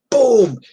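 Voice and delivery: high, drawn-out boom falling in pitch; imitated impact boom. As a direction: voice a heavy blow landing. Imitating table leg blow.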